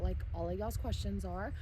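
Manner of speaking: normal talking voice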